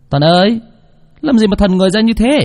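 loud high call to a daydreaming friend. Acted. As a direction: shout his name to snap him out. Friend calling out his name.

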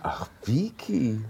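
gedehnt und tonlos geflüstert. Acted. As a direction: haucht den Namen ganz langsam. Ehemann flüstert zur Frau. (flüsternd, ertappt)

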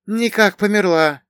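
high, loud villager drawl; drawn-out remark. A narrator's reading. Bogdan guessing beside his companion that she has died, drawn-out. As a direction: stretch it out in a high character voice. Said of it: "протянул рядом Богдан".

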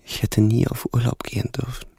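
voiceless, regretful whisper; despairing whisper. Acted. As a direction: breathe it out in regret. Pauls Reue nach Abschied. (geflüsterte Reue)

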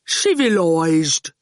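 slow and calm, almost lazy; unbothered thug drawing it out; slow placid menace; placid goon. Acted. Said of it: "he remarked placidly".

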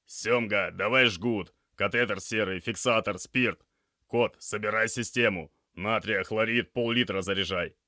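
loud string of urgent orders; brisk, commanding medic; issuing instructions. A narrator's reading. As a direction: rattle off a list to the crew, projected. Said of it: "принялся выдавать указания".

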